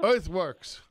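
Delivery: mock Yiddish pronunciation, emphatic; Yiddish accent. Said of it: "it's my Yiddish accent".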